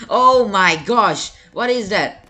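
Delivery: high, loud frightened cry, slow on the first words; scared shriek. Real, not acted. Sudden scare in horror game.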